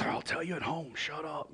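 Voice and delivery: softly muttered brush-off; hushed husband. Acted. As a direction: hush her under your breath in public. Husband hushing wife on a date.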